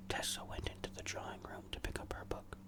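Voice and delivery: usual speaking voice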